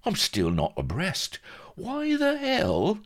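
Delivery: baffled sidekick demanding answers; demanding Vladimir. A narrator's reading. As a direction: build from puzzled to squeakily exasperated. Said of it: "he demanded".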